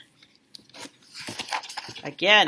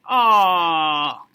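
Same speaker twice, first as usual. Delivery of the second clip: fond aw; 5 semitones lower than usual. Real, not acted. Touched reaction.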